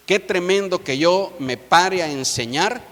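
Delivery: usual speaking voice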